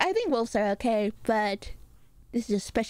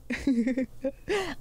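short chuckle at her own impression; real giggle; amused laugh; 2 semitones higher than usual. Real, not acted. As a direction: laugh at your own mimicry.

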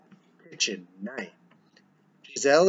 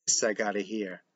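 amused smiling; 4 semitones lower, 6 dB quieter than usual. Acted. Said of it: "Joey, smiling".

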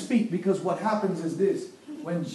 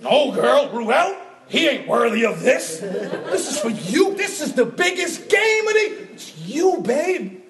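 high, loud, laughing pitch to win her over; gushing to his wife; 4 semitones higher, 4 dB louder than usual. Retold or imitated. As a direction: oversell the gift with a laugh in the voice. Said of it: "I'm like".

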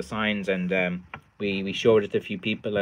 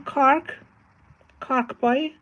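put-on much higher, lilting Cork accent; mock Cork accent; much slower than usual. Streamer shows viewers how Cork lads sound, high Cork accent. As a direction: squeak it up like a Cork lad. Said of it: "they always speak higher".

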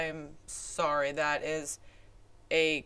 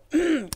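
clearing throat; 10 semitones higher, 7 dB louder than usual. Real, not acted. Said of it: "excuse me".

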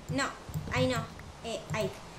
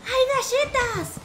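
high squeal of delight at the cookies; excited squeal; 10 semitones higher, 4 dB louder, much slower than usual. Real, not acted. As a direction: squeal with joy over found cookies. Finds cookies in the game.

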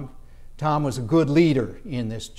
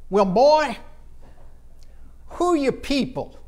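put-on old local voice, pitched up; old Savannian; 7 semitones higher than usual. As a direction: imitate an old Savannian sizing up a newcomer. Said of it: "I'm talking old Savannians asking me".

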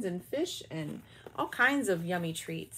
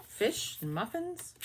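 usual speaking voice

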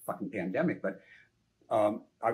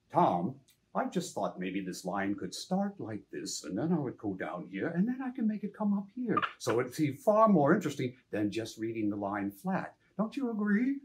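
Adam West impression; 2 semitones higher than usual. Actor pitching line readings to his director in Adam West's voice. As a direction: play the line like a grand old TV hero. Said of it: "You do a great Adam West impression".

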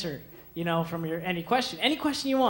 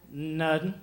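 bored guy voice; 4 semitones lower, 4 dB quieter than usual. Acted. Guy's bored reply.

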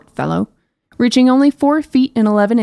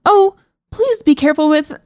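anxious plea; 7 semitones higher, 5 dB louder than usual. Acted. Princess pleading as cage drops.

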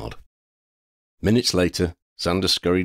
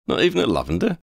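high-pitched playful tease; needling him lightly, pitched up; teasing; 14 semitones higher, 4 dB louder, faster than usual. A narrator's reading. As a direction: tease a friend with a high lilt. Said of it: "Dean teased".

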